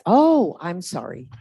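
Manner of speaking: normal talking voice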